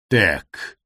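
low guttural rasp; guttural demon voice. Acted. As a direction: growl it from the throat. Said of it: "изрекает гортанный голос".

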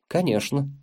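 ordinary delivery

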